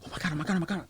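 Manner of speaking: very soft, rapid mock panic; panicked muttering. Handcuffed inmate spotting the man he informed on, muttered panic. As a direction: panic under your breath, repeating it.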